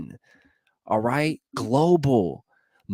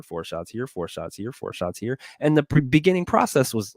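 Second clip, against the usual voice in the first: ordinary talk, nothing marked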